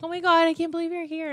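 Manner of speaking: mock starstruck gushing; excited fan. Voices an excited fan greeting her.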